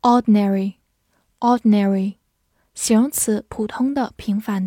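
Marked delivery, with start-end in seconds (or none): 0.0-2.2 American English "ordinary" with a full "-nary" ending; "ordinary" in a General American accent; American pronunciation. Shown as a demonstration. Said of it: "美式发音".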